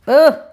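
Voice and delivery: loud sharp groan of hurt; pained groan. Real, not acted. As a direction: let out a stung ugh. Reacts to hurtful words.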